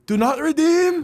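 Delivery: loud, high-pitched scammer scream; imitated screaming. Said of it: "He's just screaming".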